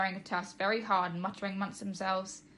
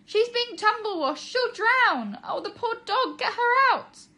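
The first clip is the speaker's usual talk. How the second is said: very high, louder frantic wailing; panicked; 14 semitones higher, 8 dB louder, slower than usual. Acted. Mum wails in panic about her trapped dog. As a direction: wail it in full panic.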